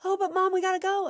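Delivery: high, loud mock-kid protest. Kid nagging mom to leave, high mock pleading.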